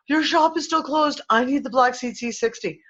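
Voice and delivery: high-pitched mock desperate customer; imitated pleading customer. Seller imitating customers' messages, high-pitched mock pleading. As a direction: plead like an impatient customer, played up.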